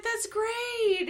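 high-pitched imitation of a gushing wife; mimicked high, delighted approval; delighted praise. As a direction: gush it way up high. Imitates the delighted wife's reaction.

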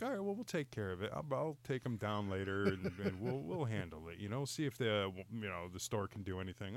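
low, soft, unbothered reassurance; calm and cool. Retold or imitated. Wife tells angry husband she'll handle it, calm and cool. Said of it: "calm and cool about it".

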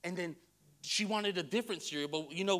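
ordinary delivery

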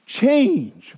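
"Change" high, falling firmly; emphatic change. Shown as a demonstration. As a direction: proclaim "Change". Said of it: "retranslate repent".

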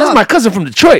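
high, loud exclamation; mock outburst. Wrestler claiming his cousin from Detroit, mock outburst. Acted punchline of the argument.

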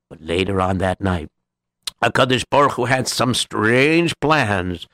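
ordinary delivery, nothing marked

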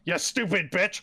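put-on angry outburst; mock pissed off. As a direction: imitate a villain losing his temper. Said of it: "getting pissed off at Catwoman".

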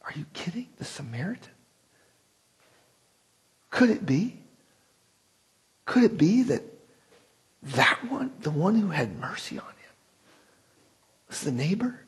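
hushed wonder dawning; awed whisper. Retold or imitated. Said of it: "Maybe he said it like".

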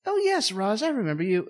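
high, lilting acted recognition; mock effusive greeting. Niles greeting Roz at the party, high mock-effusive voice. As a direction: sing out a polite greeting up high.

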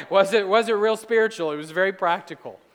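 laughing through a teasing question; amused, teasing aside. Real, not acted. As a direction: chuckle at the crowd while asking.

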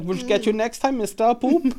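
put-on high, silly camp German accent; playful threat from a camp German villain; hammed-up German accent, very high; comic Nazi voice. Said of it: "if you do a Nazi voice".

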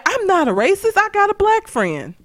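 high-pitched imitation of denial; mock self-defense. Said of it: "That's like saying".

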